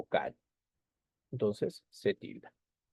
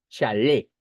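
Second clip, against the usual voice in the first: chalé with a louder final LÉ; stress on final syllable; 6 dB louder than usual. Shown as a demonstration. Teacher showing students where the word's stress falls, stressing the last syllable of chalé. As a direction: hit the LÉ of "chalé" hard. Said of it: "la mayor fuerza de voz se encuentra en le".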